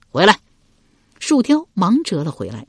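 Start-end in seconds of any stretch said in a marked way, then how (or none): 0.1-0.4 loud, curt summons; barked command. A narrator's reading. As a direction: call the underling back sharply. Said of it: "管仲复又喝道". (大声喝令)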